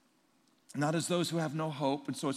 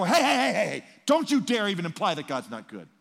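loud, high scolding, then laughing; scolding rebuke; 5 semitones higher, 8 dB louder than usual. Retold or imitated. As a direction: shut down the mourner harshly. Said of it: "I don't go".

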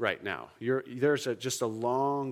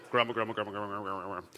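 quick low mutter, getting softer; low grumbling; 5 semitones lower, 4 dB quieter, much faster than usual. Shown as a demonstration. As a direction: demonstrate grumbling under the breath. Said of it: "This is what grumbling sounds like".

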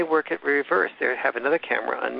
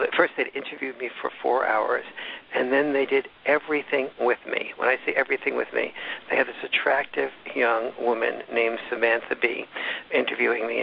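ordinary talk, nothing marked

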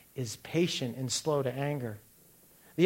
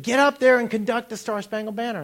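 higher and louder, repeating the order; insistent called order, higher; calling out; 7 semitones higher, 5 dB louder than usual. Retold or imitated. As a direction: insist from across the field.

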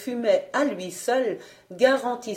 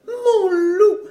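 high, theatrical, melting adoration; swooning voice; 6 semitones higher, 4 dB louder than usual. A narrator's reading. Wife swoons in her husband's grip, high swooning voice. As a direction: plead as if fainting with love. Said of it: "d'une voix pâmée".

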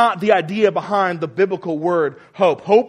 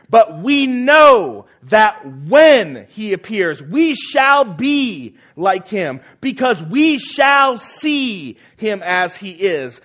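louder and slow, pressing each phrase; forceful reading; 3 semitones higher, 5 dB louder, much slower than usual. Shown as a demonstration. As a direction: preach the promise with weight, slowly. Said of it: "notice the force behind these statements".

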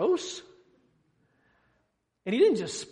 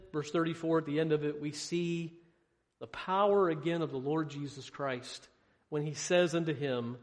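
ordinary delivery

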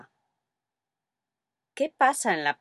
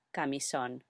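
soft aside; 4 semitones lower, 10 dB quieter than usual. Real, not acted. Alternative word added softly.

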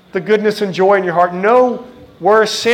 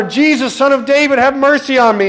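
pleading cry, pitched up and a bit louder; retold beggar calling out to Jesus over the crowd; 5 semitones higher than usual. Blind beggar shouting to Jesus past the crowd, mock pleading cry. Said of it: "cries out even louder".

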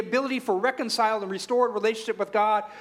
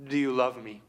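voice of Christ; 7 semitones lower than usual. Acted. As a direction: ask tenderly, letting it fall away. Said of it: "Jesus asks".

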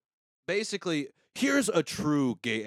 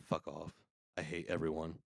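low, soft, fed-up aside; muttered disgust; 8 semitones lower, 11 dB quieter than usual. Real, not acted. Reacting to a YouTuber's comment, muttered disgust. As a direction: drop it low and quiet, sick of people.

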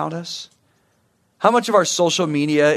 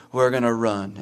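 mumbled, softer, unwilling team answer; reluctant team reply; 6 semitones lower, 5 dB quieter than usual. Retold or imitated. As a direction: answer like tired runners who dread it. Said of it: "And you all go".

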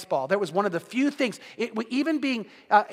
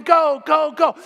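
cheering shout; 5 semitones higher, 7 dB louder, slower than usual. Retold or imitated. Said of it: "they're going".

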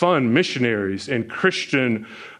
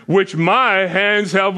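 boastful king; 6 semitones higher, 4 dB louder, much slower than usual. Retold or imitated. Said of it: "and he says, what?".

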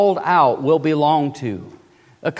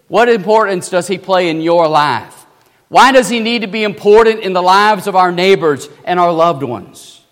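ordinary talk, nothing marked

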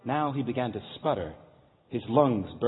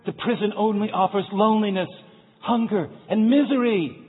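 calling out; 10 semitones higher, 8 dB louder than usual. Acted. Guide calls across the gorge.

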